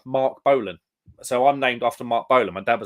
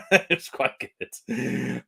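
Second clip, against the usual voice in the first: laughing; much slower than usual. Real, not acted. Laughing about getting smashed.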